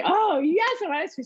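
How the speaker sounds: a high, eager squeal of a yes; enthusiastic kids. Retold or imitated. Mom voicing her excited kids wanting ice cream. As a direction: jump at the ice cream like a happy kid.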